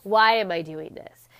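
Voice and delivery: ordinary delivery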